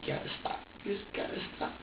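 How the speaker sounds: put-on soft whispered plea to the baby. A mother retelling her whispered plea to her baby, whispering. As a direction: hush it out, pleading. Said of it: "I was like, I was like".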